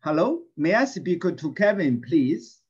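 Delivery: loud clear drill voice for the class; teacher leading a repeat-after-me drill. Shown as a demonstration. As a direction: say it clearly so they can repeat. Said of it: "Read after me, please, three times".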